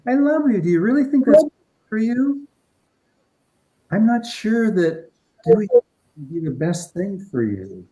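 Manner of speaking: high, loud, sugary mock concern; manipulative loved one. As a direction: imitate a smothering mother pleading. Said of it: "that type of manipulative energy".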